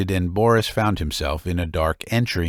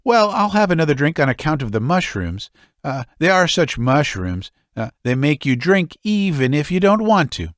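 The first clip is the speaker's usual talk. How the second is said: higher and quick, making excuses; woman excusing her drink before the stranger, higher; coy woman; 6 semitones higher than usual. Acted.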